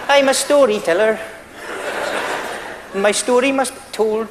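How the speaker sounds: lilting Irish bard, grand and mysterious; Irish storyteller. Pretend. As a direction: announce yourself like a mystical Irish bard. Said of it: "So, the Irish fellas".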